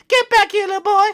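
very high, loud falsetto yell; shrieking store lady. Acted. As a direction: screech after the runaway boy. Store lady chases fleeing boy.

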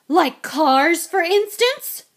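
loud, high, pointed question; sharp wife, climbing at the end; accusing. A narrator's reading. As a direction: pounce on him with loud sarcasm. Wife confronts husband with a poker.

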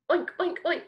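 three quick pig oinks. Shown as a demonstration. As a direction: snort out short pig oinks. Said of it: "Now let's make some short sounds".

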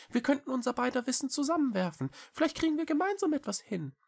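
very high, quick small-creature voice; little cat Echo, earnest. A narrator's reading. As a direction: plead eagerly in a high, fast voice. Cat persuades. (hohe, eilige Katerstimme)

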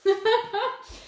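loud, high laugh at herself; self-mocking laugh. Real, not acted. Reader laughing at her own pronunciation, amused. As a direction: laugh off the stumble.

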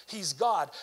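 ordinary delivery

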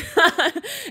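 high, loud burst of laughter; laughing. Real, not acted. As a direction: laugh out loud at your own story. Laughs at own sobbing story.

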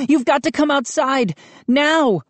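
high, pressing urging; boy urging his mother outside; urgent plea. A narrator's reading. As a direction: push her to come now.

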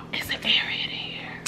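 unvoiced secret whisper; hushed aside. Real, not acted. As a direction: lean in and whisper it.

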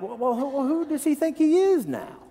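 high-pitched mock indignation; offended townsfolk. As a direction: grumble like an affronted hometown crowd. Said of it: "they got offended about him".

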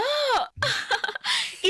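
high, ringing peal of laughs; laughing. Acted. Amused during introductions.

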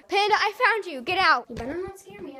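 normal talking voice

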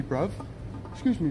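normal talking voice

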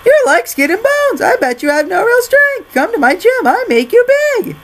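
very high, loud put-on voice; gym promoter. Promoter luring a skinny man to his gym, high character voice. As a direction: goad the skinny guy in a hammed-up voice.

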